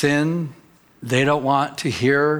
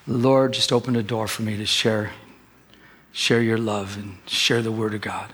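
silent prayer; 6 semitones lower than usual. Retold or imitated. Preacher recalls praying in his mind at the counter, hushed and low. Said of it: "in my mind, I'm praying".

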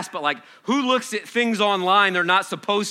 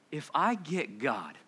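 ordinary talk

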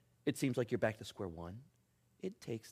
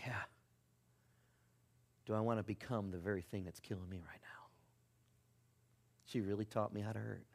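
softer and softer, down to a whisper; hushed whisper; 7 semitones lower, 14 dB quieter than usual. Real, not acted. Pastor confiding his own hurt to the congregation, hushed whisper. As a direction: let it land quietly, almost to yourself. Quiet confession of painful memory.

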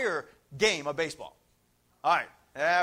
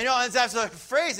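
ordinary talk

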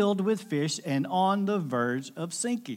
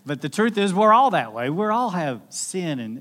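usual speaking voice

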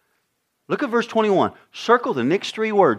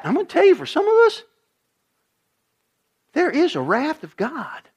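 ordinary talk, nothing marked

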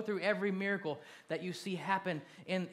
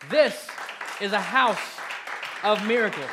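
shouted out, much louder and higher; loud proclaiming; 5 semitones higher, 11 dB louder than usual. Shown as a demonstration. Pastor leading the congregation's chant, full shout. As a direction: belt it to the back of the room. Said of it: "everybody say this".

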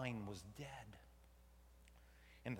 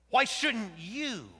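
louder, higher and slow; loud emphatic question; 9 semitones higher, 12 dB louder, slower than usual. Acted. God pressing Jonah, loud and slow. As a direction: push the question hard.

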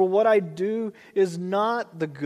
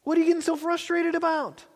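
much higher, exasperated call; God calling out; 10 semitones higher than usual. Retold or imitated. Preacher voices God calling to a frustrated believer, exasperated high call. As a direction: call to him like an exasperated parent. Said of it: "And God's like".

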